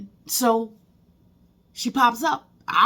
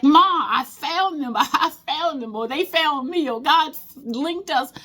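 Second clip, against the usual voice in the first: higher, slow, tearful happy calling; joyful call to mom; 9 semitones higher, 4 dB louder, slower than usual. Retold or imitated. Calling out to her mother about the found family, tearful joy. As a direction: tell your late mother the news, laughing. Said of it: "I was like".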